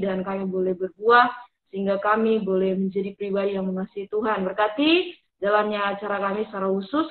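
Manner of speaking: earnest spoken prayer. Acted. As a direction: pray aloud, sincere and measured. Opening prayer for the session. (doa yang khusyuk)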